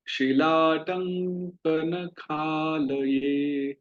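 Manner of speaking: very slow chant-like prayer recitation; slow Sanskrit recitation. Shown as a demonstration. Teacher leading students in Narasimha prayer: slow recitation. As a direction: recite each line slowly for the class to repeat. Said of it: "चलिए मेरे साथ उच्चारण कीजिए".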